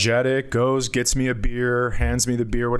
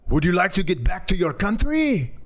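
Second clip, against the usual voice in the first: French caller voice; 5 semitones higher than usual. Retold or imitated. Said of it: "someone on the other line going".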